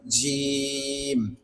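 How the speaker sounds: জিম with a very long held vowel; demonstrating articulation. Shown as a demonstration. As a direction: stretch জিম slowly, softly, mid-tongue on palate. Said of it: "তার বরাবর তালুর সঙ্গে লেগে যাবে".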